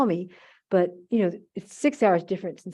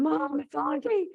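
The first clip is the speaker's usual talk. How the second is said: retold scream; 8 semitones higher, 4 dB quieter, slower than usual. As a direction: imitate an excited nephew calling Mom. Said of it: "My nephew starts screaming".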